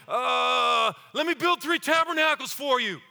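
blurting out, loud and high; eager, over-excited outburst; Peter. Acted. Peter interrupting on the mountaintop, blurting excitedly. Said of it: "what did Peter say".

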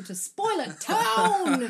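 drawn-out, rising hype shout; hyped announcement. Pretend. Podcast host warns listeners spoilers are starting, hyped announcement. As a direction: stretch it out like a big announcement.

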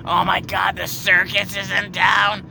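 high, loud, drawn-out gushing; mocking impression of a loud circus fan; loud excitable woman. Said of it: "That's exactly how she talked".

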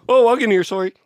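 put-on Canadian accent. Answering a request for walking here as a Canadian, giggly Canadian accent. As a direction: play an apologetic Canadian, breaking into laughter. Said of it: "Say I'm walking here in a Canadian accent".

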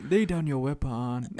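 put-on film-style Nigerian accent, stilted; mock Nigerian accent. Mocking the film's research, put-on Nigerian accent. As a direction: parody a misplaced Nigerian accent. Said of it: "who speaks like that besides Nigerians?".

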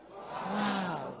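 soft, drawn-out mock amazement; imitated group wow. Speaker imitating the obedient guests, mock-amazed. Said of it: "Y adivine cómo hacen".

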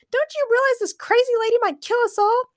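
very high frantic voice; Morgana frantic. Acted. Morgana frantically warning the men. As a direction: plead with them in panic.

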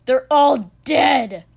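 very loud, slow furious cry; anguished, drawn-out shout; spat out. A narrator's reading. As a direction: hurl each word out in rage. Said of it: "Katsuki spat".

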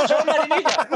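high laughing squeal; laughing hiccups. Real, not acted. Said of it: "que tengo hipo".

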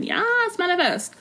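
high-pitched hyped-up cheer; mock cheering. Loves a good manifestation, playful high cheering. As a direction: squeal it like an eager supporter.

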